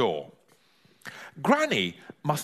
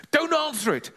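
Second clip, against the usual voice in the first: higher, hurried, panicky hiss of a warning; urgent warning; 13 semitones higher than usual. Acted. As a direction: stop her before she opens the door.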